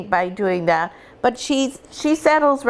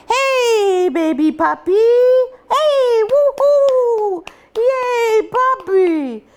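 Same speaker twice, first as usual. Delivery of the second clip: high, loud, excited puppy cheer; playful loud call; 4 dB louder, much slower than usual. Shown as a demonstration. Owner cheering at the puppies, high and loud. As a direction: whoop it up at the pups with a laugh. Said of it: "the next time you go".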